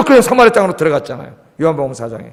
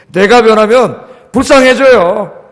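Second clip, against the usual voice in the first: louder, slow stress on 불쌍해져요; emphatic preaching; 7 dB louder, slower than usual. Real, not acted. Preacher pressing the congregation, slow emphatic stress. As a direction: drag out 불쌍해져요 loudly.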